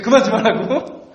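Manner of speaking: wife's snapping retort, half-laughing; mock outburst. Husband retelling how his wife snapped back at him, laughing mock outburst. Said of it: "제 아내가 버럭 화를 내는 거예요".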